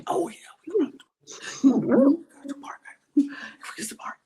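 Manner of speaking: conspiratorial whisper. Retold or imitated. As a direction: whisper it up high, plotting. Retelling whispered plotting.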